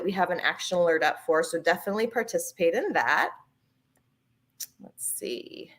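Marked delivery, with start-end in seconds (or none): 4.8-5.7 breathy whisper to herself; whispered aside. Real, not acted. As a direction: say it under your breath. Muttered aside while reading comments.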